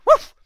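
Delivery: quick woof; short bark for the dog. Acted. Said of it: "Bobby gave a bark".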